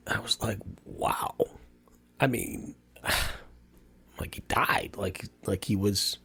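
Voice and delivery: a real shaken whisper, barely voiced; stunned whisper. Real, not acted. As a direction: let the shock take the breath out of the words. Learning the boy had died.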